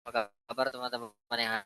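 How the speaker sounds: ordinary talk, nothing marked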